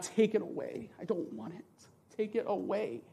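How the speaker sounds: hushed, pleading take it away; quiet prayer of surrender; soft pleading prayer. Retold or imitated. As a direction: beg God quietly.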